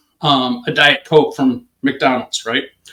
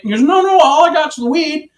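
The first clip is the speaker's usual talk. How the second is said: much higher pitched, imitating a protesting suspect; retold protest; 11 semitones higher than usual. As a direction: protest your innocence in a high mock voice.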